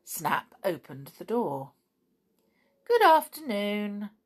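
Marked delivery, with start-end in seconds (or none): 2.8-4.1 higher, louder courteous greeting; polite greeting. A narrator's reading. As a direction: greet the visitor with a show of politeness. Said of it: "he said quite politely".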